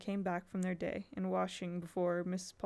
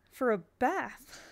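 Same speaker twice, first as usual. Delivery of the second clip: "For a bath?" slow, louder, amazed rising; 4 semitones higher, 5 dB louder, much slower than usual. Acted. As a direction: drag out the disbelief. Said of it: "Barney's voice rose in amazement".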